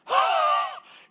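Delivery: huge high gasp; hammed-up excited gasp. Johnny seeing the boss arrive, exaggerated excited gasp. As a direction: gasp loudly, bursting with news. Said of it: "I'm just gonna role play this".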